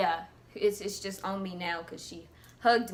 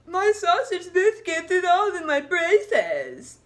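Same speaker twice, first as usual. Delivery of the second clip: mock whiny boy, higher and louder, slowed; loud boy with mouthful; 7 semitones higher, 5 dB louder than usual. Retelling Chad at breakfast, whiny mock boy voice. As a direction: ham up a kid with food stuck in his braces.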